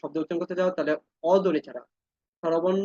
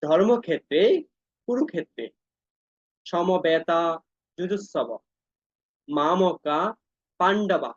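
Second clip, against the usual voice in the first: each word set apart with pauses, Pandava split off; broken into pieces; much slower than usual. Shown as a demonstration. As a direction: pause after every word of the verse. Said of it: "এই ভাঙ্গাটা দেখো একটু". (প্রতিটি শব্দ আলাদা করে ভেঙে বলা)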